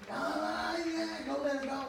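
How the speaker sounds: high, agitated acted-out outburst; retold agitated cry. Retelling the fight his mother broke up, high agitated cry. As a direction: cry out high, re-enacting the quarrel.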